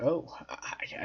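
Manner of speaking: imitating a stunned hushed whisper; surprised whisper. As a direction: breathe it out in disbelief. Hogan at first is like.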